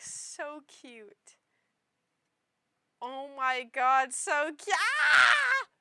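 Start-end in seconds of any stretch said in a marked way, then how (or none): 3.0-5.7 loud delighted shriek; excited shout. Real, not acted. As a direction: shout it with glee. Shouting over cute card.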